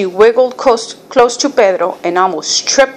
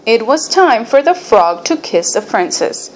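ordinary delivery, nothing marked

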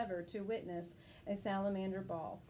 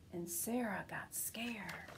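whispered; 4 dB quieter, slower than usual. A narrator's reading. Scary moment in story.

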